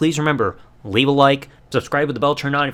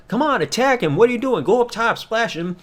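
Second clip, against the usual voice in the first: higher, pushy egging on; urging on; 7 semitones higher than usual. Retold or imitated. Bloodline egging Jay on, pushy higher urging. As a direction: goad him to jump in. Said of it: "The Bloodline is looking at him being like".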